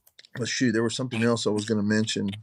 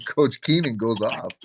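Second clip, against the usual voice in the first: ordinary talk, nothing marked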